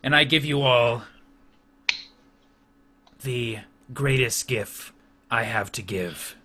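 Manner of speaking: slow, stately warlord proclamation; solemn orc chieftain. Acted. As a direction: bestow a great honor with weighty pauses. Chieftain offers lieutenancy.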